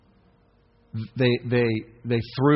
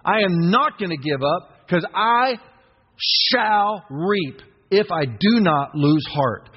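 slow, higher, firm affirmation; slow declaration; 5 semitones higher, slower than usual. Shown as a demonstration. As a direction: declare it slowly to your own soul. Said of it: "Say it to your soul".

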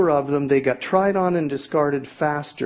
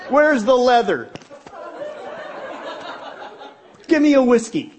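exaggerated higher desperate call; desperate mock plea; 9 semitones higher than usual. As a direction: beg for a drink, hammed-up.

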